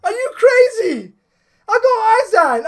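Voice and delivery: high-pitched disbelieving joy; excited. Real, not acted. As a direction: cry out in happy disbelief. Rejoicing over the pull.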